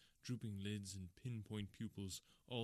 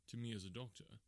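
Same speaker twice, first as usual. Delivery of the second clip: ordinary delivery, nothing marked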